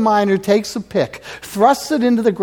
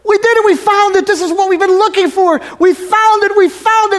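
much higher, louder joyful shouting; gleeful miners; 13 semitones higher, 6 dB louder than usual. Retold or imitated. Miners dancing around after finding the nugget, shouting with joy. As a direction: cheer like miners striking gold. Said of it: "they started dancing around".

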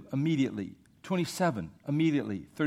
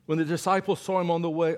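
ordinary talk, nothing marked

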